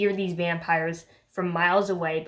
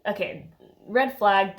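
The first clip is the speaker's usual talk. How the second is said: ordinary talk, nothing marked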